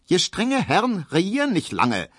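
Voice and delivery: put-on high squawky old granny; high-pitched, quacking old woman; squawking grandmother. A narrator's reading. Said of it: "quäkt Großmutter Neuendorf langsam".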